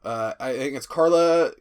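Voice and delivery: normal talking voice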